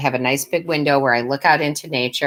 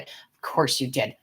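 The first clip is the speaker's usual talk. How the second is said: ordinary talk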